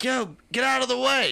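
high, loud call at a pedestrian; imitating shouting at a walker to move; retold yell. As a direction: yell it at someone blocking the bike lane. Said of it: "I was like".

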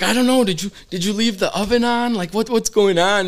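high, rattled mock bluff; feigned innocence. [name] bluffing to his mom, high fake-innocent panic. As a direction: play dumb like a busted kid. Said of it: "we're trying to hide it".

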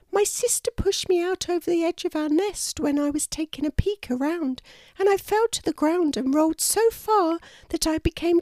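high, small bird voice; a lost little chick explaining herself; kookaburra chick voice. A narrator's reading. Baby kookaburra telling the kangaroo how she fell, small high voice.

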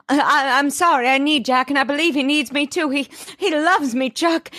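high, stammering melodramatic plea; hammed-up emotional plea. Elizabeth defending Jack to Chuck, melodramatic and high. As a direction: overact a trembling heartfelt plea.

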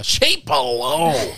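slow, drawn-out high mock echo; mock dramatic. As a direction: echo it back slowly, teasing. Mocking a friend's boast.

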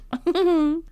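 high, loud burst of giggles. Real, not acted. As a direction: burst out laughing. Laughs between lines.

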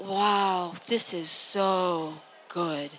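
slow, soft, awed sigh of pleasure; hushed bliss. Retold or imitated. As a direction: breathe it out in wonder. Recalling shower bliss.

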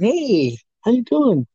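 high, lilting hello; retold greeting. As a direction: re-enact a greeting, pitched well up. Retelling his own greeting on arrival.

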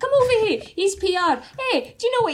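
high, loud Irish voice; excited Irish accent. Retold or imitated. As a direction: shout it in an Irish lilt. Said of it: "Can I make her Irish?".

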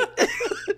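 high burst of real laughter. Real, not acted. Telling friends the story, high real laugh. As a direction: crack up.

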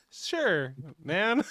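ordinary delivery

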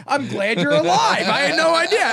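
mock cheery greeting. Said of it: "That should be your opening line".

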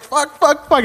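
high, rapid mock-flustered swearing; retold panic. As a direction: act out frantic searching mid-call. Panicking while searching Google during call.

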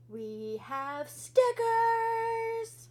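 loud, high, drawn-out cheer; excited announcement. Real, not acted. Host to listeners about new merch, excited cheer. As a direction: stretch STICKERS out in a big high shout.

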